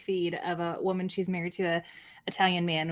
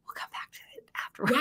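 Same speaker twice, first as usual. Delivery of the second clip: whispered aside, like sharing a secret; playful whisper; 10 dB quieter, faster than usual. Acted. A parent telling the kids with a sneaky whisper. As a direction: let it slip out in a conspiratorial whisper.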